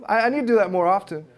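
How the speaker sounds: usual speaking voice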